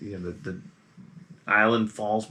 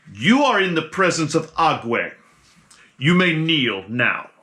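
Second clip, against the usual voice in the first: slow, louder proclamation; regal god voice; 5 semitones higher, 4 dB louder than usual. Acted. Regal figure on the raft speaks.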